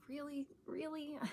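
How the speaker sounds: high-pitched, incredulous "really?"; mock doubt, pitched well up; sarcastic disbelief. Vlogger mimicking an exchange that makes her sarcastic, high mock disbelief. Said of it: "Then I get kind of sarcastic".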